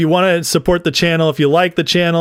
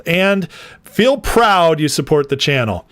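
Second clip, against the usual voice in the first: ordinary talk, nothing marked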